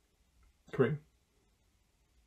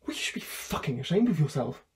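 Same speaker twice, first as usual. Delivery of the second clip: ordinary delivery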